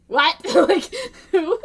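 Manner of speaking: shocked what. Retold or imitated. Retold shocked reaction.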